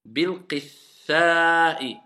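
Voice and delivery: Bil-qitha'i drawn out very slowly; very slow recitation. Shown as a demonstration. Teacher echoing the student, very slow. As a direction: spell out Bil-qitha'i syllable by syllable. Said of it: "il y a une shidda sur le tha".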